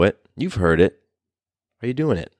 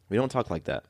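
much softer repeat, drifting off; soft echo; 10 dB quieter than usual. Shown as a demonstration. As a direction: let the repeat fall away softly. Echoed line, much softer.

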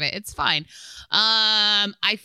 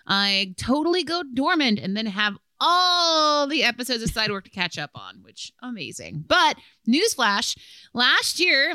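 animated read-aloud with punched key words; lively letter read; 5 semitones higher, 4 dB louder than usual. Real, not acted. Host reads a server submitted story, animated read-aloud. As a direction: read it out brightly to the audience, swinging the pitch.

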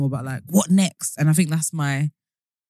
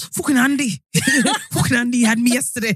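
mock kid complaining; 6 semitones higher than usual. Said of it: "And it's like".

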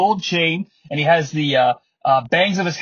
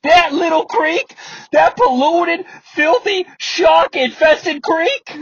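mock outrage; 8 semitones higher, slower than usual. Russo mocking a man's muddy creek, incredulous and slow. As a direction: sneer at the creek in disbelief. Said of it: "Russo goes".

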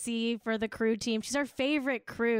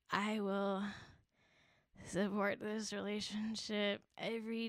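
much softer mock-solemn pledge; hushed devotion; 6 dB quieter than usual. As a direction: vow it quietly like a fan. Devoted pledge to Florence Pugh.

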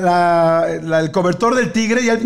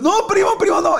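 panicked shout; 12 semitones higher than usual. Retold or imitated. Kids scream at the cousin about to throw up: high panicked shout. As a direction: shriek it as he starts to heave.

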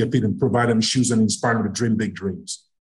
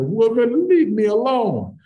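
higher, slow mock-whiny brush-off; mock complaint; 9 semitones higher, slower than usual. Husband brushing off wife's question about quitting, mock whine. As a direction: push her away in a hammed-up whine. Brushing off his wife again.

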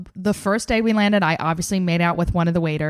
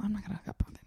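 soft hushed whisper; 14 dB quieter, much faster than usual. Retold or imitated. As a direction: whisper it like a secret vow. Whispered resolve quote.

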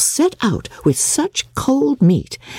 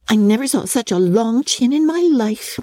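mocking Lydia; 8 semitones higher than usual. A narrator's reading.

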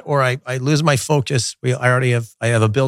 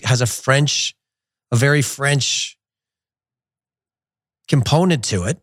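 ordinary talk, nothing marked

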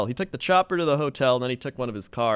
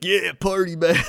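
party bro voice; 6 semitones higher, 5 dB louder than usual. Retold or imitated. Douchey brother voiced.

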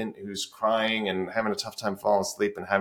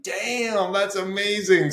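amazed; 10 semitones higher, slower than usual. Retold or imitated. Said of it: "that I'm like".

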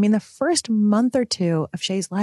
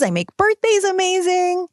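perky brag; 10 semitones higher than usual. Real, not acted. Brags about being the fun one.

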